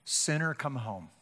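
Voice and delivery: soft, low sinner come home; hushed call. Retold or imitated. Preacher retelling the call he heard, hushed and low. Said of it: "I heard Jesus say through another person".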